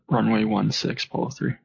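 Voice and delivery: ordinary talk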